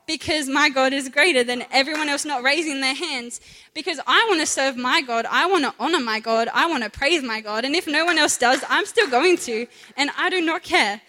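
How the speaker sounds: fervent sermon build; preaching at full force. Acted. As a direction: proclaim it like a pulpit preacher. Preaching on worshipping God.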